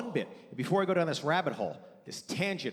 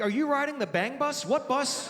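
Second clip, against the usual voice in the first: raised, acted disbelief; incredulous questioning; 6 semitones higher than usual. Dad grills son about the bus, mock incredulous. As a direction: demand answers like an appalled dad.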